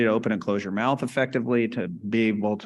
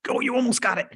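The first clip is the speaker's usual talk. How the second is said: much higher, coaxing cheer; encouraging; 13 semitones higher, faster than usual. Retold or imitated. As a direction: urge her on as she nearly gets the word. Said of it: "you're like".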